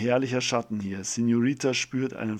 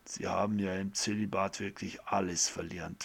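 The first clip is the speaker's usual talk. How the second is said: lower, softer, sly whispered jab; teasing whisper; 3 semitones lower, 6 dB quieter than usual. Acted. Doctor teases the priest about celibacy in a whisper. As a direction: whisper the tease behind a grin.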